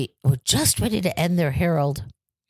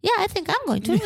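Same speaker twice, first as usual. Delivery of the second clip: high-pitched cheeky student reply; cheeky defiance; 13 semitones higher than usual. Retold or imitated. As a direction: imitate students teasing their teacher, voice way up. Students' cheeky answer to furious teacher.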